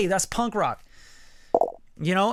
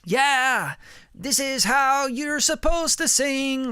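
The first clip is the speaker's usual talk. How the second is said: higher, slow, put-on proper voice; teasing Brian about his restrained singing, in a mock proper voice; mocking stiff singer; 8 semitones higher, slower than usual. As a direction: parody a singer holding back. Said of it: "You're like".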